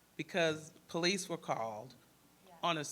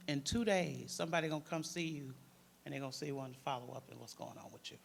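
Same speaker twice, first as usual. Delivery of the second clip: usual speaking voice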